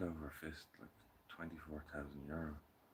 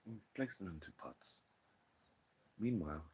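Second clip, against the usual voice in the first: usual speaking voice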